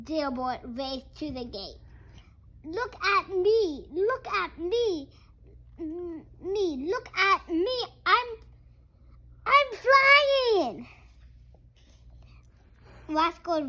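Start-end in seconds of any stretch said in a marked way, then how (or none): 2.6-10.9 high, loud look-at-me shouting; excited duckling. Acted. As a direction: crow it out like a proud duckling. Duckling showing off flying.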